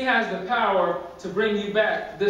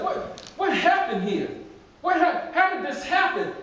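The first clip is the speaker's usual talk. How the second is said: shocked devil; 7 semitones higher than usual. Acted. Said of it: "and he's like".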